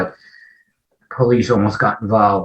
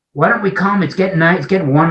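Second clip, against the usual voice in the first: higher, inviting friends' voice; friends' coaxing; 8 semitones higher, faster than usual. Retold or imitated. Friends coax him to let them visit, higher and inviting. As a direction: sell him on a beach visit. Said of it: "They said".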